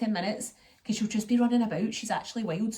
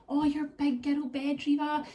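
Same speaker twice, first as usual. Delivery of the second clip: put-on cooing to toddler; 6 semitones higher, slower than usual.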